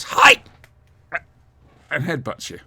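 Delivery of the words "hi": squeaky high toy yelp; a tiny action figure shouting. Retold or imitated. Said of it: "you press it and it goes".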